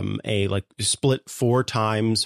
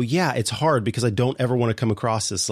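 ordinary talk, nothing marked

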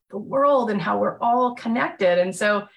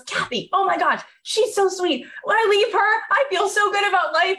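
mock gushing voice, much higher; gushing admirers; 11 semitones higher than usual. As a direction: imitate starstruck colleagues raving, pitched up high. Everyone gushing over Kathy, quoted.

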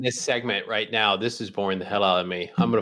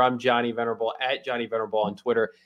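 normal talking voice

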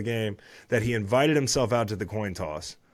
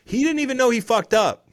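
much higher, loud disbelief; incredulous; 13 semitones higher, 8 dB louder than usual. Real, not acted. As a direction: exclaim it in disbelief. Laughing rant about coin toss.

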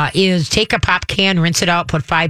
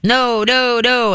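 higher, repeated nagging no's; nagging owner; 7 semitones higher, much slower than usual. Retold or imitated. Owners no their dogs to death, nagging. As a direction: imitate an owner scolding on and on. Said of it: "most people know their dogs to death".

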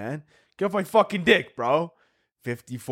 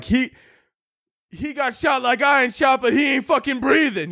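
mock hype yell; 13 semitones higher than usual. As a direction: yell it like a clueless party guest. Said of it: "You're like".

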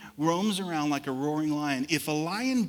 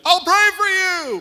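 much higher and louder breezy promise; mock sweet promise; 12 dB louder than usual. Pastor mocks people promising prayer, high breezy voice. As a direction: toss it off like an empty promise. Mocking empty promise to pray.

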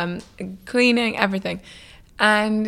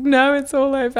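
mock despair; 6 semitones higher, 4 dB louder than usual. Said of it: "I was just so scared".

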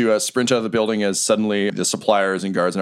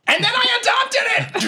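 very high, drawn-out character cry; hammed-up shout; slower than usual. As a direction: yell back with absurd theatrical gusto. Game master shouts back in character.

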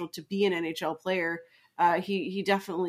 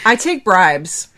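joking loud offer; 11 dB louder, slower than usual. Acted. Joking offer to reveal the secret.

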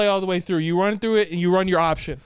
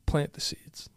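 whispering; 3 semitones lower, 7 dB quieter, slower than usual. Acted. Whispered advice catchphrase.

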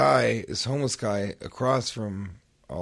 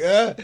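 mock stumble cry; 9 semitones higher, 11 dB louder than usual. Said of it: "but I was like".

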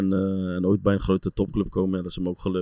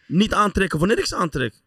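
mock angry Zlatan; 10 semitones higher, 4 dB louder than usual. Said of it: "hij zei gewoon".

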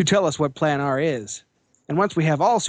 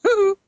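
loud whooshing whew; 5 dB louder than usual. Acted. Sound effect in the comic.

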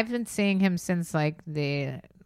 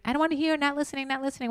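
mock refusal to listen; 8 semitones higher, 4 dB quieter, much faster than usual. Mocking people who refuse to hear.

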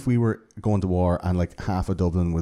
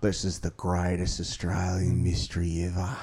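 imitating an Australian TV narrator, lower and softer; mock Australian true-crime host voice; Australian accent; 3 semitones lower, 5 dB quieter than usual. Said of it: "Your Australian accent's top notch".

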